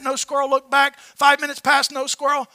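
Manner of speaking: usual speaking voice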